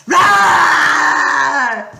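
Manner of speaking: very loud raging roar; monster roar. A narrator's reading. Medusa waking up, roaring.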